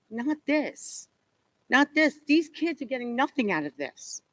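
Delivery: urgent pleading. Real, not acted. Pleading at a public meeting.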